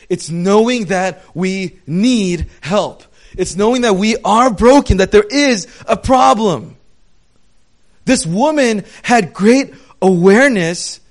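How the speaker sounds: loud, impassioned preaching; preaching at full force. Acted. Pastor urging the congregation about faith, loud and impassioned. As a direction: drive it home to the congregation.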